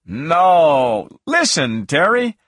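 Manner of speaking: loud, high pleading cry. A narrator's reading. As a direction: cry out urgently to be heard. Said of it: "I cried".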